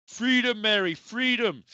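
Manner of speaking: loud, high, hammed-up Scottish cry; terrible Scottish accent. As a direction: belt it out in a bad Scottish accent. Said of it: "in a terrible Scottish accent".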